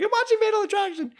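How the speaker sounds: very high, fast, panicked reply; squeaky frightened explanation; shaken teen. Retold or imitated. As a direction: blurt it out still terrified. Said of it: "I'm like".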